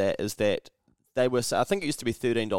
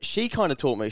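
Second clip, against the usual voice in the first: ordinary talk, nothing marked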